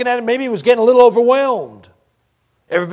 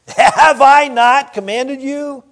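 higher, slow and drawn-out parental rebuke; acted stern reminder, raised and slow; God as scolding father; 6 semitones higher, 4 dB louder, much slower than usual. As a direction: stretch it out like a dad demanding an answer. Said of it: "That's what God's doing here".

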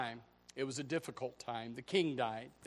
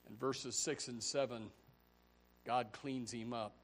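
normal talking voice

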